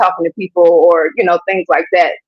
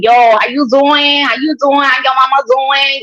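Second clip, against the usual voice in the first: lightly put-on New York accent, slower and drawn out; a playful New York accent greeting; 5 semitones higher than usual. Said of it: "I'm gonna bring that New York accent".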